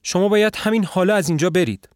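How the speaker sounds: panicked, push him out before her husband comes; fear and agitation. A narrator's reading. The wife urging the visitor to flee, high frightened whisper-turned-plea. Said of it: "با ترس و هیجان میگه".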